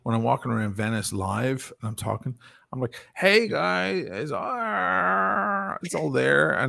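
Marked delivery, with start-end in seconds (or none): 3.1-6.5 higher, brighter street greeting; high everyday voice. Shown as a demonstration. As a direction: chat up passers-by. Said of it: "not as nice as this voice".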